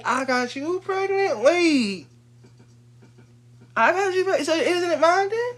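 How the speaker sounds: high, loud indignant rant; exasperated, high-pitched challenge; heated argument. Acted. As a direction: argue back loudly, pitch climbing to a squeal. Acted argument over children and equality.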